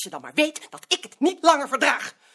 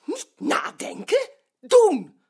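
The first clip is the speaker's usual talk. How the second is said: sharp command; 8 semitones higher, 3 dB louder, much slower than usual. Acted. She cuts off his dithering.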